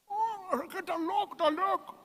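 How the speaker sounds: high squeaky mock disgust at salt; mock shocked reaction. Priest voicing a listener tasting salt, mock shock. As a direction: pull a face at the salty taste, high and thin.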